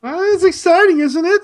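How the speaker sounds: high, bright mock excitement; excited. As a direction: oversell the guest. Hyping the guest.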